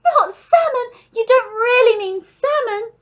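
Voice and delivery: high, loud, thrilled disbelief; shrieking pelican at full voice; screeching. A narrator's reading. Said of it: "screeched the pelican".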